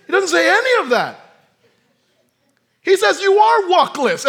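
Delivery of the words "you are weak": loud, high mock Jamaican accent saying "You are walkless"; playful Jamaican voice. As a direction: belt it out in a Jamaican lilt. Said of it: "as a Jamaican would say".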